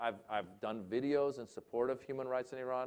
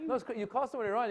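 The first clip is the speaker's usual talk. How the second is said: excited; 10 semitones higher, 3 dB louder, much faster than usual. Real, not acted. Excited retelling of phone echo.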